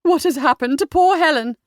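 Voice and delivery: high, loud, distressed mother; fretful wail for POOR Helen; alarmed cry. A narrator's reading. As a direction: cry out in worry. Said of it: "cried Millicent".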